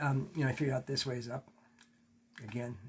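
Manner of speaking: ordinary talk, nothing marked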